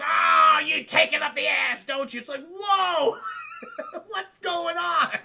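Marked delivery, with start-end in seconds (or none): 0.0-2.2 loud high jeering taunt; mocking shout. Prisoner taunting the judge in court, loud jeering shout. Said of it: "She goes". 2.5-5.2 high-pitched giggling through the words; can barely talk for laughing. Real, not acted. Streamer cracking up at the movie scene, laughing.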